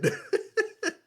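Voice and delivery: a short high burst of laughter; sudden high laugh. Retold or imitated. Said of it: "I just started laughing".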